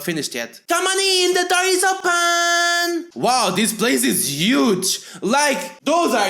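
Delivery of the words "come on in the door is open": high, drawn-out lady calling from afar. Acted. Missus Sweet calling the visitor in, high and drawn out. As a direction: call out welcomingly from another room.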